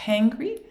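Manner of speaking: "Hangry" with a long puffed H, questioning; high, rising "Hangry" with heavy H; exaggerated H. Shown as a demonstration. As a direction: huff the H hard before "Hangry". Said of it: "I hear an H sound".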